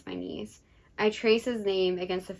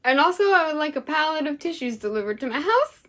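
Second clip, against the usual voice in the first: higher, louder excited joke; teary joking; 8 semitones higher, 7 dB louder than usual. Real, not acted. Host joking about tissues, excited. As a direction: keep laughing through it.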